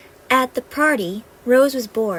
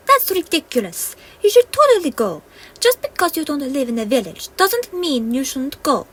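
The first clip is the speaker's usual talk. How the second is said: high, louder fairy scolding; Penelope urging the wizard; insistent fairy; 5 semitones higher, 6 dB louder than usual. Acted. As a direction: push him to go.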